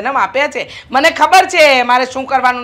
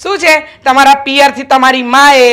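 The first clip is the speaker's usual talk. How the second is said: higher, louder, bossy scolding; 5 semitones higher, 6 dB louder than usual. Acted. Mother-in-law to daughter-in-law, loud bossy scolding. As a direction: lay down the law loudly to the new bride.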